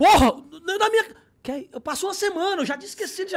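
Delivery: high, loud offended protest; indignant outburst. Retold or imitated. Neymar protesting he waited a week, high and loud. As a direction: blow up like an insulted star.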